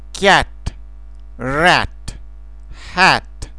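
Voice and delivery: "cat", "rat", "hat" with a wide, bleating open a; open English vowel. Shown as a demonstration. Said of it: "seamănă cu behăitul oii". (vocala deschisă ca behăitul oii în "cat", "rat", "hat")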